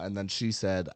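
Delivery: ordinary delivery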